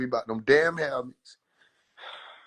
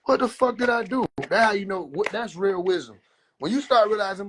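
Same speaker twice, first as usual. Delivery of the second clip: normal talking voice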